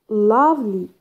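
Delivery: "Lovely" with a strong, drawn "Love"; stress on first syllable. Shown as a demonstration. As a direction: lean hard on "Love" in "Lovely", let "ly" fall. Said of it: "stress يجي on the first syllable".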